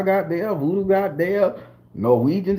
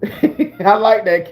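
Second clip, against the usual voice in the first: slow, laughing through it; 4 dB louder, much slower than usual. Real, not acted. Enjoying the remark, laughing. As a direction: laugh as you say it.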